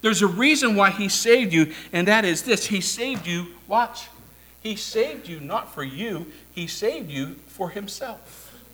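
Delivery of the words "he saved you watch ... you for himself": much softer, confiding delivery; quiet emphasis, slowing at the end; hushed preaching. Real, not acted. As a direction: drop the voice right down for the key point. Preacher drops voice for key point.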